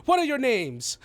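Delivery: high, declaiming pompous voice; lofty, theatrical self-importance; grandiose halfling dignitary. Acted. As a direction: proclaim it to an awed crowd. Game master plays grandiose halfling.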